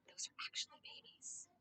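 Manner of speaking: hushed whispered aside; whispered disbelief. Real, not acted. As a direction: breathe it out as a secret.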